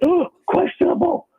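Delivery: high, fretting fantasy owner; mock panic, pitched high; worried fan voice. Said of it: "People will look at Des Green and say".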